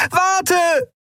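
very high, shouted plea; high, drawn-out cry; desperate scream. Acted. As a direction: scream for him to stop. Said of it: "schrie sie".

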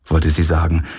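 ordinary delivery

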